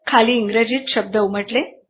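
usual speaking voice

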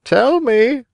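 very high bird voice; inquiring pigeon. A narrator's reading. Pigeon questioning a marionette, high and curious. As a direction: ask in a high-pitched pigeon voice. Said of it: "asked the pigeon".

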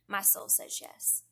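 slow whisper of "my soul says yes"; whispered prayer. Shown as a demonstration. Speaker closing her prayer to the Lord in a whisper. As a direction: breathe it out as a private whisper. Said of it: "we all have to come to that point where we say".

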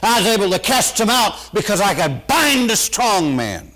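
loud, high excited preaching. Acted. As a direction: shout it out to the congregation. Preaching at full force.

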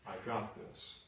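a hushed "I got this"; quiet assurance. Acted. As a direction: say it under your breath, certain. Quiet repeat after doctor's verdict.

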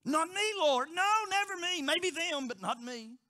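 loud, high insistent protest; proud denial. Retold or imitated. Believer boasting to the Lord, loud proud protest. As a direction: insist you're the exception.

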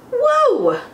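high, wide-eyed whoa; big storybook whoa; wondering whoa. Acted. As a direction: gasp of wonder for the kids. Wonder at brightest planet.

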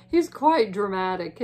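ordinary delivery

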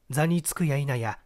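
plain reading voice

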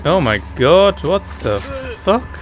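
high, drawn-out shocked cry; shocked outburst. Real, not acted. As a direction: react in stunned disbelief. Shocked reaction to game scene.

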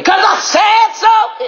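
high, drawn-out declaration; yelling. Real, not acted. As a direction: yell it slowly, every word stretched. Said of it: "You don't have to like me yelling".

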